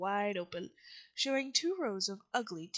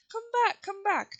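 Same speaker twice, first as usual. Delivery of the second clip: raised, high calling voice; 10 semitones higher, 6 dB louder than usual. A narrator's reading. Woodman calls to the fleeing mice, raised calling voice. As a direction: call them back across a distance. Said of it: "while he called to the mice".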